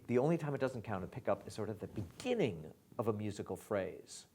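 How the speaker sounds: normal talking voice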